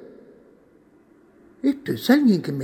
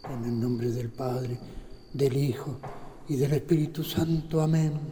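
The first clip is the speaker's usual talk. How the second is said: quiet frightened prayer; 5 semitones lower, 6 dB quieter than usual. Acted. Rezo susurrado a solas, con miedo. (rezo murmurado, lento y bajo)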